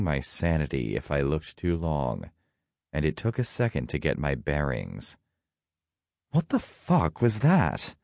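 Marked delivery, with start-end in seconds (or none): 6.3-8.0 high-pitched, alarmed outburst; startled character's exclamation; startled cry. A narrator's reading. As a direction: cry out in shock, pitch jumping up. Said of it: "Loki cried".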